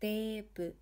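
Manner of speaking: TE in "Teepu" held long; extended "ee" in Teepu; long vowel. Shown as a demonstration. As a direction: stretch the "Tee" of Teepu before a short "pu". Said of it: "we are extending the te".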